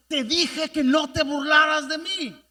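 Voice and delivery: loud, accusing retort; angry reproach. Retold or imitated. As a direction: throw the blame at him. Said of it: "empieza a reclamarle".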